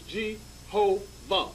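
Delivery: Jehovah sounded out slowly, syllable by syllable; split into syllables. Shown as a demonstration. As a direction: spell out Jehovah in three slow beats. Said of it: "Three syllables".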